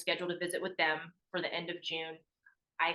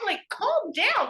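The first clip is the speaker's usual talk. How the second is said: "calm down" much higher, louder and drawn out slowly; exasperated plea; 7 dB louder, much slower than usual. Retold or imitated. Daughter begging her frantic mom on the phone, high, slow exasperated "calm down". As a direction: stretch "calm down" out high and loud, pleading with her.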